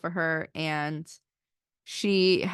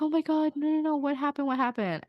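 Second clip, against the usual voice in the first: high, rushed concern; worried friend; 8 semitones higher, faster than usual. Retold or imitated. Roommate rushes to console Liz, high worried voice. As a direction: comfort a crying girl, alarmed. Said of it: "She's like".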